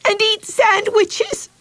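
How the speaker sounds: very high, slow sobbed "and eat sandwiches"; sobbing. Acted. Betsy sobs into Mother's lap about the picnic, sobbing. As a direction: wail it through tears. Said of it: "sobbing as if her heart were breaking".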